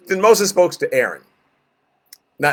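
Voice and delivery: ordinary talk, nothing marked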